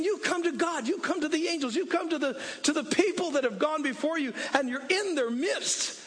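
rally the church like a coach at a game; full-force preaching. Acted. Preaching climax.

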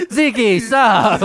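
high scolding call; Aunt Vicky. Retold or imitated. Friend imitating aunt calling off Zeke, high mock scolding. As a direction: yell it like an aunt in the background. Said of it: "Remember Aunt Vicky in the background?".

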